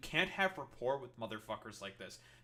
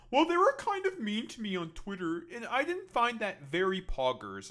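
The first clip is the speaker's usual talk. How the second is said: higher and louder, whiny mock complaint; mocking whiny online crybaby; 6 semitones higher, 7 dB louder than usual. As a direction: sneer at them with a high, loud, whiny parody. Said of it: "generally present is just like".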